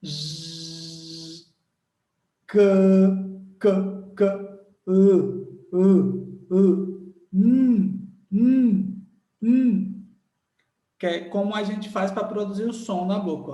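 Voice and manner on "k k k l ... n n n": K, L and N as loud held letter sounds. Shown as a demonstration. Teacher modelling K, L and N as loud sustained sounds. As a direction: hum the L and N long. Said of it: "Olha os sons".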